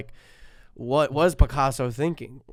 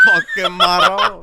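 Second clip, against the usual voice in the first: slow, loud, higher mock Italian exclamation; echoed dad exclamation; 6 semitones higher, 9 dB louder, slower than usual. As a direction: repeat it like the awed dad. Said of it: "echoing the dad impression".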